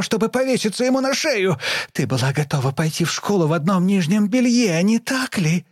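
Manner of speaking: very high screaming rant; hysterical tirade. A narrator's reading. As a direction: tear into her mother without a breath. Daughter screams at mother.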